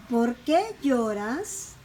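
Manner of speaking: slow POR QUÉ, lloras stretched and rising; teacher's drawn-out example question, lifting on lloras; question intonation. Shown as a demonstration. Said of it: "la pronunciación cambia".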